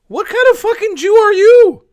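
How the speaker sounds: furious yell. Real, not acted. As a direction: scream it at him in real fury. Outraged rant at a panelist.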